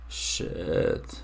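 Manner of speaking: ordinary talk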